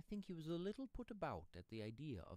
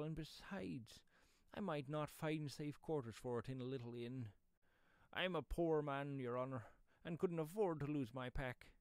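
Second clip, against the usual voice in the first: higher, louder coaxing rustic; wheedling pedlar; 4 semitones higher, 6 dB louder than usual. Acted. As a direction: coax him like a poor travelling seller.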